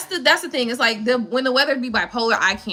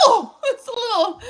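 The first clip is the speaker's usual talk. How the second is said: mock shivering Floridian; 9 semitones higher than usual. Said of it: "I'd be like".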